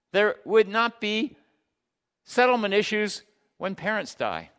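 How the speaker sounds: high, loud, insistent pulpit voice; impassioned preaching. Real, not acted. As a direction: hammer out each promise to the congregation. Preaches at full force.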